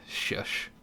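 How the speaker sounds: normal talking voice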